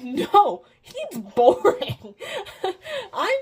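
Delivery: loud, slow, laughing no; laughing dismissal. Retold or imitated. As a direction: toss it off through a laugh. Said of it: "she's like".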